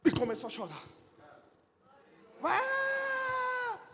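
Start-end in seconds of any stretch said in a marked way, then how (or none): 2.4-3.8 high shouted cry of relief; father shouting with joy as son revives; joyful shout. Retold or imitated. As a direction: burst out in a long joyful shout. Baby revives, father exclaims.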